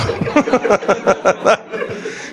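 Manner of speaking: breathy high laugh; airy laugh. Real, not acted. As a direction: laugh out in delight. Continues laughing. (śmiech z radości)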